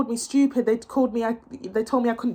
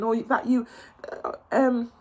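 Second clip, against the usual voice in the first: usual speaking voice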